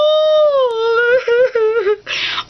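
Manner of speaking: high loud crying laugh; laugh-sob at yourself. Retold or imitated. Mocking own sensitivity, loud sob-laugh.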